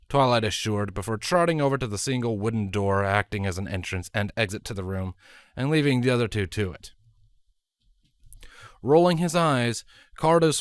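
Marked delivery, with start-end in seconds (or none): none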